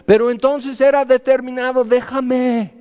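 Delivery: hammed-up "leave me alone"; mock defiant. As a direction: toss off "déjame" loud and high like a defiant kid. Mocking determinist excuse.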